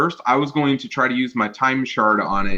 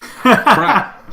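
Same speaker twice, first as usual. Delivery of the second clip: alarmed; 10 semitones higher, 6 dB louder than usual. Real, not acted. The robot starts a self-destruct.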